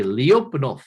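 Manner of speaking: Lyapunov said with emphasis on the second syllable; relished Russian name. Shown as a demonstration. Lecturer has the class repeat a word he likes, Lyapunov stressed on second syllable. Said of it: "I like saying the word".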